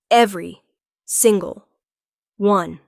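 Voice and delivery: a vow hammered home word by word; emphatic promise. A narrator's reading. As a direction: hit "Every", "single", "one" one by one. Emphatic promise while dipping her.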